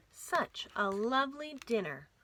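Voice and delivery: plain reading voice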